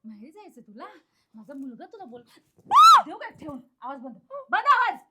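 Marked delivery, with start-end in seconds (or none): 4.5-5.0 high, very loud bark; explosive angry order; furious command. Acted. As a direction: shout her into silence. Orders captive to be silent.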